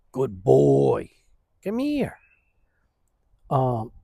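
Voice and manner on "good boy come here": affectionate pet voice; dog talk. Real, not acted. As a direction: sweet-talk the dog to come. Calling his dog over.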